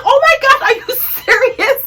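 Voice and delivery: high-pitched, incredulous retold reaction. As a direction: shoot up high in disbelief, with a laugh. Retelling her shocked reaction in the argument.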